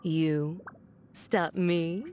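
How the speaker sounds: usual speaking voice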